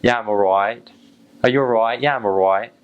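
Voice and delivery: put-on light British accent, friendly back-and-forth. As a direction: toss it off like a local Brit. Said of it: "you say".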